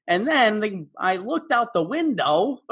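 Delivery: mock exam-recording New York accent; exaggerated New York accent. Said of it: "this exaggerated New York kind of accent".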